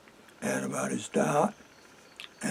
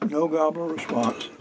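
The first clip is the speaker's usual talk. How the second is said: usual speaking voice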